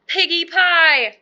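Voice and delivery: slow, loud, high cry of delight; drawn-out piggy pie. A narrator's reading. Narrator relishing the witch's craving, slow and loud. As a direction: savor each word with greedy glee. Witch craves her favourite dish.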